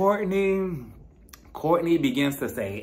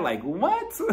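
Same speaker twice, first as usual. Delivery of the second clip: usual speaking voice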